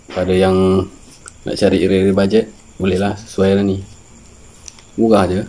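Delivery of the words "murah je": normal talking voice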